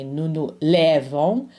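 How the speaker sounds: lèvons with an open, stressed first syllable, "LEH-von"; lèvons mispronounced with a heavy open è; wrong stress demo. Shown as a demonstration. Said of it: "We don't say".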